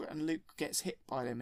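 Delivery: normal talking voice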